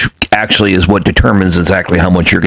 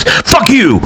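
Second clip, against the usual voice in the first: sudden high mock yell; retold outburst; 14 semitones higher than usual. Old customer cursing the clerk over rent, high mock yell. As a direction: snap it out like a grumpy old man. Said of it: "He goes".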